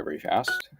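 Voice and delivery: ordinary talk, nothing marked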